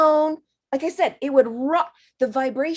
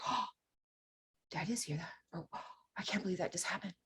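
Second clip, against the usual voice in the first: fast breathless whisper of disbelief; stunned whisper; 4 semitones lower, 18 dB quieter, much faster than usual. Retold or imitated. Student stunned at first hearing spirit, fast whisper. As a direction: whisper it fast, unable to believe it.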